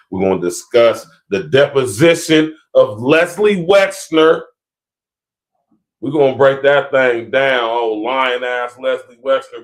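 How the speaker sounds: loud, slow hype call; announcing tomorrow's topic to viewers, loud and slow; hyped announcement. Real, not acted. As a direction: shout it out like a promo. Hyping tomorrow's topic loudly.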